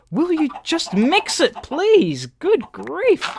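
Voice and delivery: fed-up owner scolding chickens; exasperated scolding. Real, not acted. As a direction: drag it out in despair at the chickens.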